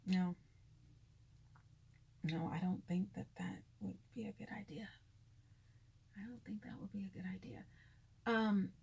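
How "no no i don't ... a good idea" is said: whispered refusal. Retold or imitated. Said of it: "I was like".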